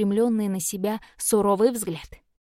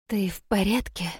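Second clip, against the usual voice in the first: voiceless, whispery croak; croaked question. A narrator's reading. Injured girl checks on her rescuer, hoarse croak. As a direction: croak it out under the breath. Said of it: "прохрипела она".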